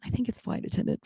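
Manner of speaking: quick whisper to herself; whispered aside. Real, not acted. Checking the word mid-story, in a quick whisper. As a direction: slip it in under the breath, fast.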